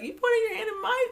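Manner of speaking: high, loud and fast mock outrage; indignant retort. Recapping the argument to viewers, mock-indignant. Acting out an angry confrontation.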